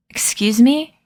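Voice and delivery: put-on lightly imperious Russian accent, rising in pitch; affronted question with a Russian accent. A narrator's reading. Folding man turns on Emma, annoyed Russian accent. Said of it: "he said in a thick Russian accent".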